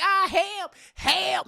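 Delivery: high, loud mock-panicked yell; cry for help. Clown being beaten by Madea, high panicked holler.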